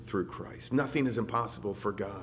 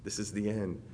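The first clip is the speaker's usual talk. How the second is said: fearful thought; 3 semitones lower, 9 dB quieter than usual. Retold or imitated. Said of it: "and you think".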